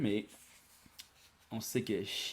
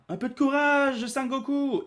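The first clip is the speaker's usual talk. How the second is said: much higher, louder encouragement; cheering on; 8 dB louder than usual. Acted. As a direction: cheer him on from afar. Cheering Goku on.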